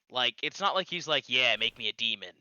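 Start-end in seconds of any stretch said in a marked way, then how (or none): 1.3-2.3 mock edgy. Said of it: "It's not quite that edgy".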